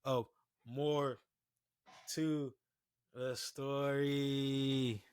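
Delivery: slow, drawn-out announcer outro; dramatic show sign-off. Shown as a demonstration. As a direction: stretch the show name out grandly. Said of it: "let me hit it again".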